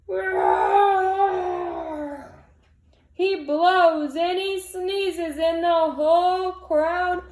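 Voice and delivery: big loud storybook roar. A narrator's reading. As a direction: let the roar burst out. Bear roars and sneezes.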